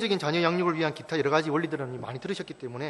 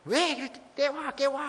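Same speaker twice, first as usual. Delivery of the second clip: high-pitched imitation of a furious mother; shrill-pitched scolding, repeating the accusation; mock angry outburst; 11 semitones higher than usual. Pastor as mother angry at child who woke the baby, mock outburst. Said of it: "화가 나서 애를 때립니다".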